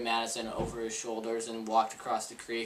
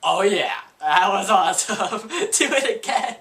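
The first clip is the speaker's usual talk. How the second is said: loud and higher, laughing through the words; laughing delight; 5 semitones higher, 8 dB louder than usual. Retold or imitated. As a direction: cheer it like a thrilled kid. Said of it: "Britta's like".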